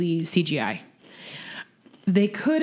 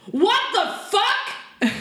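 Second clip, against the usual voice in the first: high, drawn-out shout of disbelief; re-enacted yell; 13 semitones higher, much slower than usual. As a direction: yell it slowly in mock outrage. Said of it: "pushing the mic farther away".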